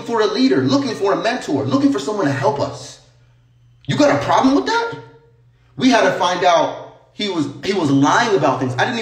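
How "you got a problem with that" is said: higher, rising challenge; confrontational challenge. Real, not acted. Streamer challenging critics of his past, confrontational. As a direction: throw it out as a pitched-up dare. Challenging the viewers mid-rant.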